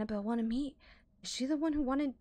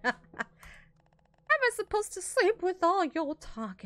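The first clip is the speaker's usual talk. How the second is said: grumpy roommate voice; 5 semitones higher, 4 dB louder, slower than usual. Acted. Voicing grumpy sleeper.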